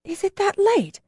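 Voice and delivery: suddenly notices the time; surprised. A narrator's reading. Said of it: "asked in surprise".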